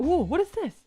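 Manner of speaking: ordinary delivery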